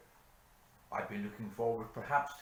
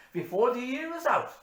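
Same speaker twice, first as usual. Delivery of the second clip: climactic despair; 14 semitones higher, 9 dB louder than usual. Acted. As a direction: throw the doom out at full force. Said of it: "said Hanrahan".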